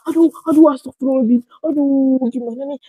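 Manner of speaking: mock panic, tumbling out; panicky. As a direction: re-enact a fretful fluster. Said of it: "kan kita panik ya".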